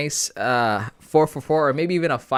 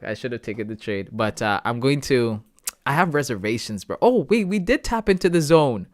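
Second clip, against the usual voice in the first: normal talking voice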